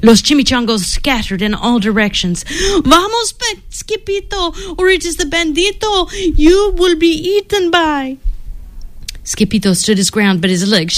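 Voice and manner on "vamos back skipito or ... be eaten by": panicked high voice. Acted. Chihuahua fleeing in panic.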